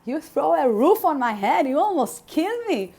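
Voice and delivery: re-enacted angry accusation at God; retold anger at God. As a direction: hurl the blame upward, replaying old fury. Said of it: "I was so angry at God".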